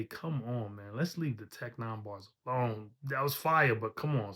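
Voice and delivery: ordinary talk, nothing marked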